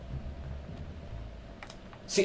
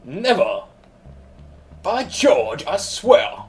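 a quick mock British accent, hammed-up; 3 semitones higher, much slower than usual. Answering the game's villain in a mock British accent. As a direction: play a stiff upper lip Brit. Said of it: "I don't even know if that was a British accent".

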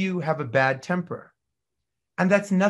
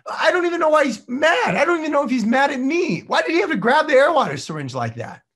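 high, loud, fast frustrated griping; exasperated staff rant; 11 semitones higher, 6 dB louder, faster than usual. Retold or imitated. Assistant venting about the moody boss, loud and high-pitched. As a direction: blurt it out like a fed-up assistant, near shouting. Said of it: "And they're thinking".

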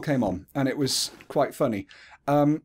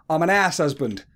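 imitated Essex accent, "house" said as "ass"; quick Essex-accented "ass husband"; 3 semitones higher, 3 dB louder than usual. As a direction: mimic an Essex man dropping his h's. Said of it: "because of his Essex accent, he went".

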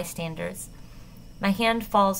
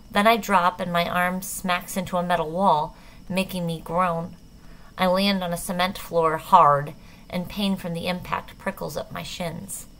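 ordinary delivery, nothing marked

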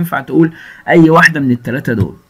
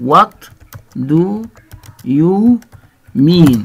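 What do you mean said one word at a time with pauses; slow word by word; 2 semitones higher, much slower than usual. Shown as a demonstration. Teacher modelling What do you mean for students, word by word. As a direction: spell out What do you mean slowly for learners.

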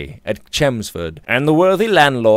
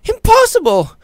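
much higher, louder, incredulous; shocked cry; 5 dB louder than usual. A narrator's reading. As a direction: disbelief rising to a cry. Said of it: "cried Alicia".

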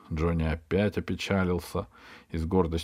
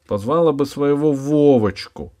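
sarcastic advice; 7 semitones higher, 7 dB louder than usual. Acted. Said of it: "посоветовал Джонни".

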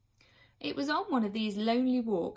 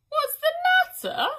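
very high, loud wailing repeat; a grieving nurse wails the question back; wailing echo; 11 dB louder than usual. A narrator's reading. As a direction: throw the question back in a howl of grief. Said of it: "said the first woman".

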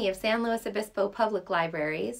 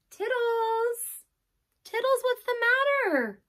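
high calling voice; 13 semitones higher, 3 dB louder than usual. Acted. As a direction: call the cat from afar. Calling out for the cat.

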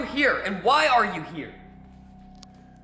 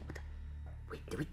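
whispering; 5 semitones lower, 17 dB quieter, faster than usual. Acted. Hiding after teleporting.

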